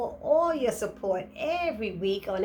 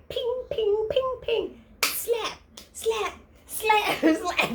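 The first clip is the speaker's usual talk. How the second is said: high playful "ping" and "slap" noises, laughing at the end; fight sound effects; 9 semitones higher, much slower than usual. Retold or imitated. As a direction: make the slap noises like a cartoon.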